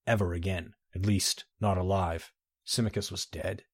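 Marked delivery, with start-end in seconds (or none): 2.6-3.6 quiet grief. Acted. Refrain, hushed.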